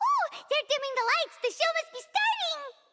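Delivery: high, bubbly with delight; excited. Real, not acted. Paimon thrilled the magic show is starting, excited. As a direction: squeal with eager joy.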